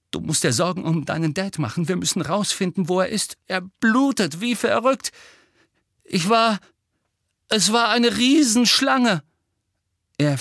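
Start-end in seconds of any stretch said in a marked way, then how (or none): 0.1-9.2 high, urgent, growing louder; frantic. Acted. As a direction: build to a panicked outburst. Boy urges friends to act. (panisch, immer lauter drängend)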